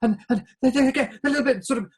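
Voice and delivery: excited. Shown as a demonstration. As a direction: show bubbling inner excitement. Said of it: "excited like this".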